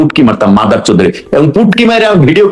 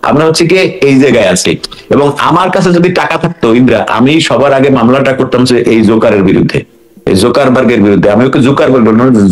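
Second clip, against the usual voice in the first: ordinary talk, nothing marked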